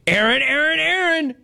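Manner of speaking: sing-song call of the caller's name. Real, not acted. Calling out to caller.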